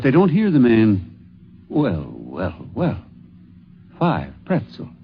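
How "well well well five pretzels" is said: a lower, softer, musing man's voice; half to himself, low and soft, a man counting pretzels; musing man outside. Acted.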